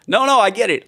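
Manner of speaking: shouted, high sarcastic "No, no, I get it"; loud mock agreement. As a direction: belt it out, overly agreeable. Mock conceding to absent critic.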